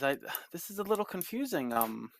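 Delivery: put-on high, puzzled girlish voice with a sigh; confused young daughter. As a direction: sound lost like a young girl.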